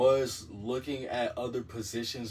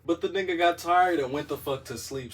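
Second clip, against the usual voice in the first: annoyed; 7 semitones higher, 7 dB louder than usual. Real, not acted. Annoyed at date.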